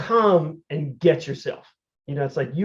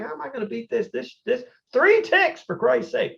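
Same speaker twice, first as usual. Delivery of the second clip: exasperated worry; 9 semitones higher than usual. Retold or imitated. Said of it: "I'm like".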